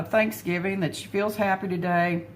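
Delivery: normal talking voice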